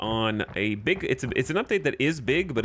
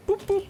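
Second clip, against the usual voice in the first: button boops. Pretend. Demonstrating pressing trade buttons.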